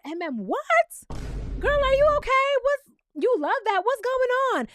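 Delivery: high, loud, stunned disbelief; incredulous. Real, not acted. Vlogger reacting to MM's answer, high incredulous disbelief.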